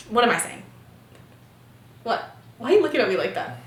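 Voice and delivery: ordinary talk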